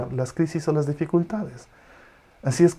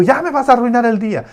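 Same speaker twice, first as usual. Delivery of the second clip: annoyed parent; 9 semitones higher, 6 dB louder, faster than usual. Retold or imitated. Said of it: "Y tú".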